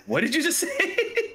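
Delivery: high, laughing disbelief; mock outrage. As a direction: squeak it out in mock shock. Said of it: "he goes".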